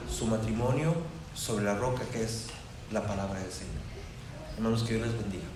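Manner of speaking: soft closing sermon. Acted. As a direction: draw the congregation in with a quiet, tender plea.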